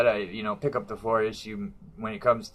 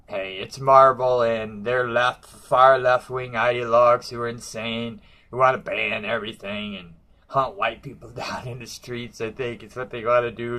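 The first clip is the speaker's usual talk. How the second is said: ordinary delivery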